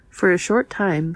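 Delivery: usual speaking voice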